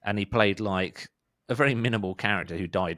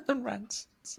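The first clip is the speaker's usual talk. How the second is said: laughing; slower than usual. Real, not acted. Laughing repeat of band name.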